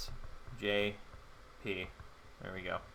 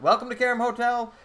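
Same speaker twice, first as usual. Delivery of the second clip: higher, louder eager desk clerk voice; a higher, louder put-on clerk greeting; chirpy hotel clerk; 8 semitones higher, 8 dB louder than usual. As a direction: greet her like an over-eager hotel receptionist. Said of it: "I shouldn't do voices".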